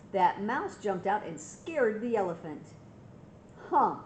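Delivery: plain reading voice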